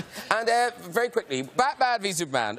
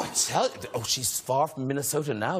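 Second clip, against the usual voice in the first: usual speaking voice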